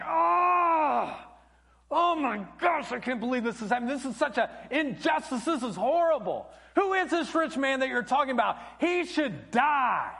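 high, loud outraged cry; furious outburst. Retold or imitated. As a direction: explode in outrage at the injustice. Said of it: "He gets furious".

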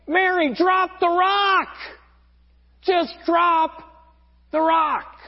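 urgent call. Retold or imitated. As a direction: call out to someone who is sinking. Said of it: "everyone is, like, saying".

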